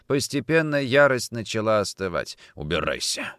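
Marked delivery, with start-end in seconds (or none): 2.5-3.3 low, spent command; hoarse dismissal. A narrator's reading. Nikitin cooling from rage, hoarse dismissal. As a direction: send him away low and hoarse. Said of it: "сказал он хрипло".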